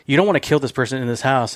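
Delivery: ordinary talk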